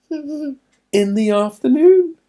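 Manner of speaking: high, slow amazed question; wondering question. Acted. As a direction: stretch it out in wonder. Father checking the time in wonder.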